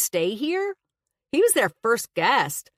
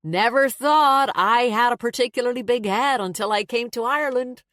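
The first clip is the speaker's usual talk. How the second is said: grinning; 6 semitones higher, 5 dB louder than usual. A narrator's reading. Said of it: "he grinned".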